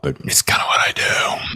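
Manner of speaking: sly whisper, half to himself; whispered aside. Real, not acted. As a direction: let it slip like a secret confession. Name-drop confession aside.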